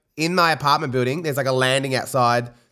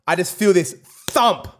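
ordinary delivery

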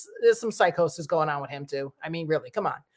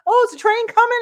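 much higher and louder, airy mock question; mocking careless rider; 7 dB louder than usual. Vlogger mocking careless riders, much higher and louder. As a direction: poke fun at people leaning over the edge. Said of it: "y'all still saying".

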